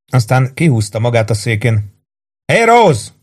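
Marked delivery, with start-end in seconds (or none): 2.5-3.1 high, raised hail; calling out. A narrator's reading. Warden summons Rose back to the bar, calling out. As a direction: call her back to the counter.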